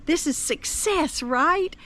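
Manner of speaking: voicing delight at success; excited cheer. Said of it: "I'm so excited because it's like".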